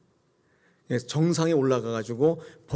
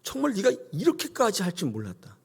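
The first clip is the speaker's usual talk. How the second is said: amazed; 7 semitones higher than usual. Acted. God to obedient Abraham, amazed. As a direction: marvel at what they did. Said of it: "하나님을 깜짝 놀라게 만들었어요".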